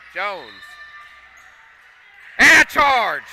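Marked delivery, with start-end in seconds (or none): 2.4-3.2 much higher, much louder burst; shouted call. Real, not acted. Announcer calling the charge on Jones, loud burst.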